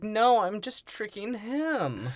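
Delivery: high, slow mock-female voice; hammed-up high-pitched explaining; woman character's protest. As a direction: draw it out high, as the scheming woman.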